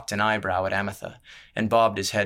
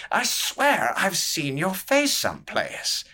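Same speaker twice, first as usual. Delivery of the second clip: put-on higher, slow drunken prodding; a tipsy noble leaning in curiously; drunk courtier; 10 semitones higher, slower than usual.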